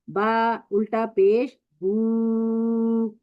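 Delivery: very slow, with बू held for two seconds; long u vowel. Shown as a demonstration. Teacher models बा उल्टा पेश बू, slow and held. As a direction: stretch बू out long. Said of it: "इसमें फर्क करना है".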